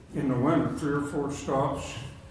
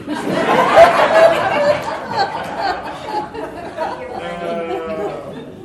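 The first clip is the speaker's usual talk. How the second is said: long hearty belly laugh; loud laughter; 8 dB louder than usual. Real, not acted. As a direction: burst out laughing at own joke. Laughs at own joke.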